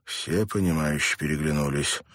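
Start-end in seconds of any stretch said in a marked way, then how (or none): none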